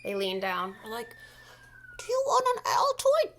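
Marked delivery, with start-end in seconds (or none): 2.0-3.4 very high, loud character voice; fussing old ladies. Acted. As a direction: offer it in a high old-lady voice. Old ladies leaning over her.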